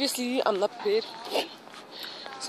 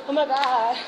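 panting from climbing stairs; out of breath; 6 semitones higher than usual. Real, not acted. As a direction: gasp between words, winded. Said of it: "I'm already out of breath".

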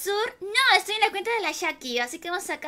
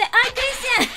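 higher, louder teasing cry; fond, louder "Ay, Cristian!" with a laugh coming; delighted exclamation; 6 semitones higher, 6 dB louder, much slower than usual. Real, not acted. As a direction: playfully scold a sleepy fan. Reacts to viewer's message.

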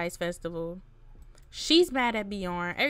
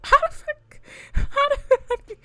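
high, loud laugh into disbelief; laughing shout of disbelief; 10 dB louder, slower than usual. Real, not acted. Gamer laughing loudly at her brawling Sim.

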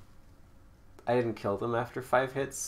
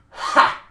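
sharp loud mocking "Ha!"; taunting laugh; 15 dB louder than usual. Gamer laughs in a rival's face, sharp mocking laugh. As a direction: bark a scornful laugh at the beaten foe.

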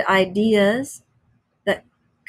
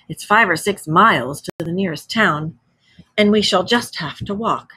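ordinary delivery

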